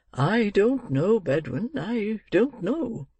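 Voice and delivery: put-on old gentleman mulling it over; doubtful old gentleman. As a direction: draw out each word doubtfully. Old gentleman fretting to housekeeper.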